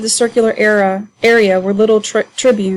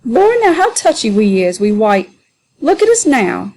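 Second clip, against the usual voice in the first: jeering Sambo. Acted. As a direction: laugh at the new men in a raised jeering voice. Sambo mocking Adolph.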